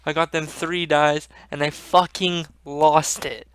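ordinary delivery

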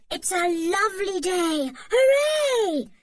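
high, loud joyful whoop on Hooray; excited cry. A narrator's reading. Julian wakes to the holiday sun, joyful loud cry. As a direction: shout for joy at the sunny day. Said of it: "cried Julian".

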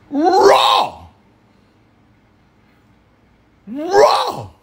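high shocked shriek of raw; scandalized, repeat it up high; shocked outcry. Real, not acted.

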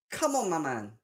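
ordinary delivery

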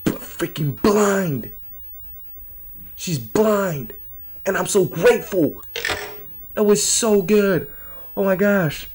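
high-pitched, drawn-out relief; thrilled relief, voice lifted high; relieved exclaiming. Real, not acted. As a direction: gush after a narrow escape. Relieved after narrowly escaping enemy.

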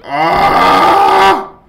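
sharp loud yelp of fright; a sudden scared shriek; startled scream. Real, not acted. Gamer jolted by a scare, loud startled yelp. Jump scare in horror game.

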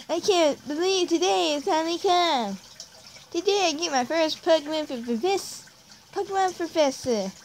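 high-pitched, slobbery cartoon hero voice; Ash Ketchum. Gamer voices his trainer starting out, hammed-up Ash Ketchum voice. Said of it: "Ash, like, Ash Ketchum-like voice".

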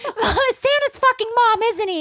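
put-on very high, loud child whine; hammed-up little kid wail; whiny child. Child voice crying to dad.

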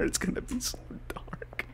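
soft, breathless frightened whisper; hushed dread; scared whisper. Real, not acted. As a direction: whisper it so the dark can't hear. Whispering in fear of dark.

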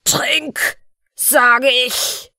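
loud, high bellowed command; roared order. Acted. The fiend roars at the dwarf to drink. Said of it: "brüllte der Unhold".

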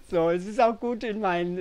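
high, loud, just out of a laugh; amused laughter. Real, not acted. Poet explains the mirrored sea to viewers, still laughing. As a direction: carry the laugh into the words.

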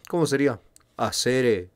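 ordinary talk, nothing marked

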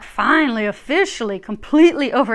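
loud mock announcement of the end; loud proclamation. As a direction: declare it loudly and with finality. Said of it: "And they keep saying, like".

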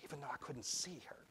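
hushed confiding whisper; very soft, conspiratorial aside; secretive whisper. A narrator's reading. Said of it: "Lowering his voice secretively".

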